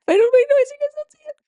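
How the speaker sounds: calling out loud and high. Shown as a demonstration. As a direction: say it loudly up high so they notice. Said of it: "Hold on".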